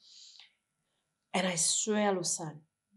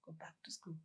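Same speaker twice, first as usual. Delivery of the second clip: soft whispered inner voice; 3 semitones lower, 18 dB quieter than usual. Retold or imitated. Recalling the dream voice to a friend, softly whispered. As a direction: hush it like a voice in the head. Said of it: "Ako ka voice".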